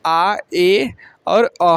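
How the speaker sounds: आ, ए और अ each drawn out slowly; slow teacher's vowel list; slow listing of sounds. Shown as a demonstration. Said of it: "ए का उच्चारण चार तरह का".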